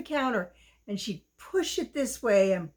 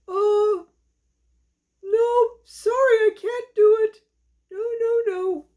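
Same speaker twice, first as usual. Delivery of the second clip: high, loud protesting dog; a little dog loudly wriggling out; doggy refusing; 10 semitones higher, 6 dB louder than usual. Acted. Dog refuses to help bake.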